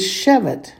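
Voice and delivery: "shevet" with the accent on "she"; SHE-vet, second syllable light; stress on first syllable. Shown as a demonstration. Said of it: "it falls on the first".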